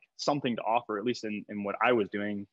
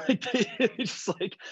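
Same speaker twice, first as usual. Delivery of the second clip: high, slow words through a laugh; 11 semitones higher than usual. Real, not acted. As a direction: crack up while talking. Cracks up about clueless athletes.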